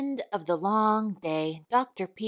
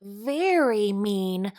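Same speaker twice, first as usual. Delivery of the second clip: slow, drawn-out snake voice; rattled; 3 dB louder, slower than usual. A narrator's reading. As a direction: hiss out how mean he is. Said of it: "rattled Jake".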